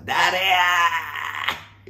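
high, long-drawn mock yell. Host acting a comedian hunting the culprit, mock yell. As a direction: holler it out, stretching the last vowel. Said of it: "で、西野さんが".

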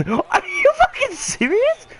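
incredulous cry. Real, not acted. Disbelief at game event.

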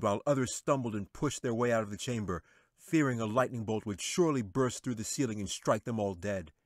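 plain reading voice

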